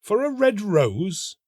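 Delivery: high, slow disbelieving rise; incredulous cry. A narrator's reading. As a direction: scoff in disbelief. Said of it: "they cried".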